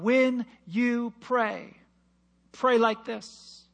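slow, deliberate, weighty; slow emphasis. Real, not acted. Preacher teaching the congregation to pray, slow. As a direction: lay each word down slowly.

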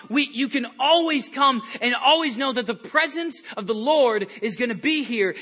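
usual speaking voice

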